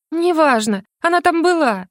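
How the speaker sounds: high, urgent insistence; frantic insistence. A narrator's reading. Heroine insisting to the mage, high and frantic. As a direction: insist it was there, pointing down.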